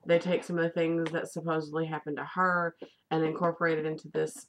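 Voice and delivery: ordinary talk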